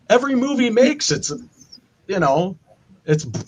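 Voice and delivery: ordinary talk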